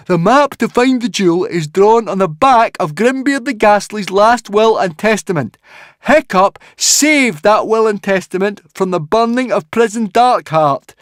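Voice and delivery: high, loud, earnest explaining; insistent Fishlegs. Acted. Fishlegs presents the will, high and insistent. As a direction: lay out the proof loudly, pitched high.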